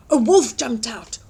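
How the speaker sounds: usual speaking voice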